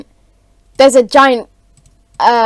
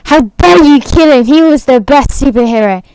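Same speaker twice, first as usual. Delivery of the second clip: loud, raised acted outrage; super angry; 3 semitones higher, 6 dB louder than usual. Giant scolds the killers of his hero, acted anger. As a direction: scold them in hammed-up fury. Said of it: "got super angry and he's like".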